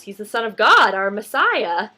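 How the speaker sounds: loud, lightly acted British wise man; British accent. Second wise man explaining to Mandy, loud light British accent. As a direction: announce it loudly with a hint of British. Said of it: "in a British accent".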